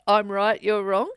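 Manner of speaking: hammed-up debate claim; playful mock of an argument; mock righteous voice.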